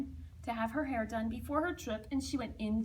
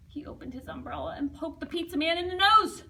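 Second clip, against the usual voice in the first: ordinary delivery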